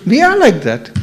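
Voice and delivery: high, light imitation of the ladies agreeing; ladies' high voice. Imitating the ladies who nod at his talk, high-pitched. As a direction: chirp it up high like the ladies nodding along. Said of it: "the ladies go".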